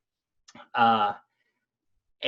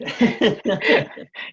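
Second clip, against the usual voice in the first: real laugh; 10 semitones higher, 4 dB louder than usual. Real, not acted. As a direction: burst of amused laughter. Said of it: "I'm laughing 'cause I have".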